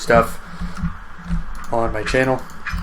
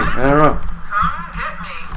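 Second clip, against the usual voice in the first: words stretched through a yawn; 9 semitones higher, much slower than usual. Real, not acted. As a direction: say it mid-yawn, slowly. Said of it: "I keep yawning all of a sudden".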